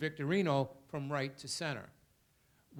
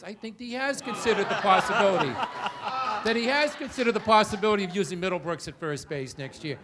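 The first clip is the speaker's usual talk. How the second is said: louder, laughing accusation; laughing while talking; 3 semitones higher, 9 dB louder than usual. Real, not acted. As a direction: chuckle through the jab.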